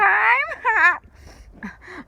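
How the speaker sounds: high, loud squawking laugh; loud parrot laugh. Acted. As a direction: cackle loudly like a parrot. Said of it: "Papagayo laughed".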